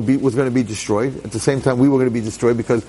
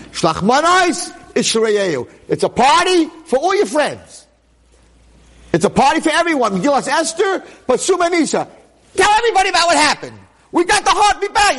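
rising celebration; 11 semitones higher than usual. Real, not acted. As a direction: burst out in festive jubilation. Rejoicing, celebrating Purim.

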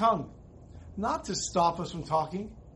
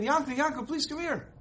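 pleading call; 7 semitones higher than usual. Retold or imitated. Rebbe calls Yankel back.

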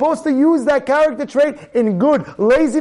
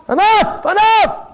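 high, sharp exclamation; sudden raised-pitch outburst; exasperated command; 9 semitones higher, slower than usual. Acted. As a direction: cut off a stubborn person. Scolding a stubborn person.

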